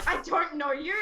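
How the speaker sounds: retold rejection with giggles; laughing refusal. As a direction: turn them down through a laugh.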